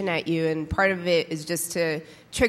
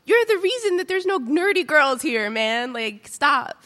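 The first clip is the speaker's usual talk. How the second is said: high, loud mock-accusing rant; indignant outburst; 8 semitones higher, 5 dB louder than usual. Cartoon guy barging into a friend's room, high loud indignant scolding. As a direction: play the outraged intruder, voice jumping up. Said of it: "a guy breaks into another guy's room and is like".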